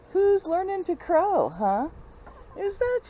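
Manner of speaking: high, slow coo; pet talk. Real, not acted. Praising the little rooster in a high slow coo.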